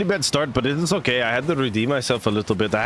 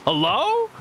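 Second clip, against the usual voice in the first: much higher, sing-song rising hello; squeaky hello. Pretend. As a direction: pitch the hello way up, playful. Greeting a subscriber, repeated higher.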